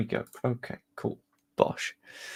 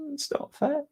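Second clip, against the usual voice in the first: mock whine; 4 dB quieter than usual. Real, not acted. Shirt wasted on training wear.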